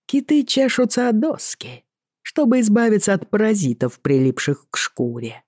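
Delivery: casual lilting Irish sea captain; offhand, in a light Irish brogue; Irish accent. Acted. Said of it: "небрежно сказал Боннет".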